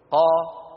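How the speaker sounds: قا with the ق opening straight into a; qaf with fatha. Shown as a demonstration. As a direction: open the ق of قا into a clear a. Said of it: "قولوا مثلي لو سمحتم". (قاف مفتوحة)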